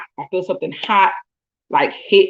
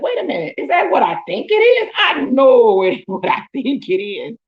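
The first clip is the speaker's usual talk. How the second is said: shocked disbelief; 6 semitones higher than usual. Retold or imitated. Realizing what spilled on her.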